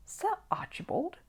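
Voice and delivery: high, soft, slow puzzled question; puzzled little bear, lifted high; in surprise. A narrator's reading. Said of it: "repeated Paddington in surprise".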